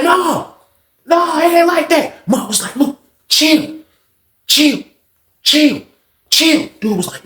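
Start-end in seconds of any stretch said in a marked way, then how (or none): 0.0-2.3 high, slow, insistent refusal; imitated agitated denial. Beach refusing to calm down. 2.8-6.7 high, spaced repeated warnings to chill; imitated warning. Mark telling Beach to chill.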